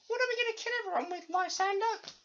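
very high put-on voice, fast; squeaky baffled underling. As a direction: question the boss in a silly falsetto. Acts out underling questioning villain Lysander.

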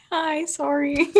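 retold embarrassed apology; sheepish apology. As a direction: squeak out a shy sorry. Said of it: "Literally, I was like".

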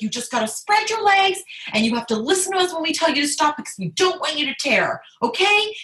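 high, urgent pep talk; excited doctor. Retold or imitated. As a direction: imitate an eager doctor giving instructions. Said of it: "he was so excited".

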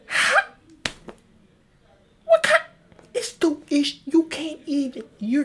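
very high, loud disbelieving squeal; shrieking what how; exasperated outburst. Real, not acted. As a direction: cry out in outraged disbelief.

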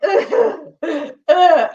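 high, loud mock sobbing groans; retold sobbing groans. Crying alone by the hot tub, loud mock sobs.

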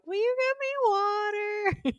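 put-on high, slow baby voice. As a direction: ask sweetly like a little kid. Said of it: "this little baby voice".

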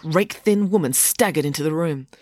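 plain reading voice

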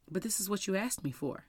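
soft, matter-of-fact voice of God; quiet, simple reminder; God's reply. Retold or imitated. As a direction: lightly voice a gentle correction. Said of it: "And then clear as day, he said".

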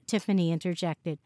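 usual speaking voice

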